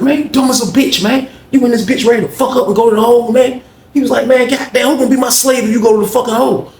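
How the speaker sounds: high, fast berating; imitating an inmate berating his lackey; Charles berating. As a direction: rip into him up high.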